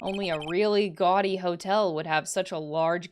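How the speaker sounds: ordinary talk, nothing marked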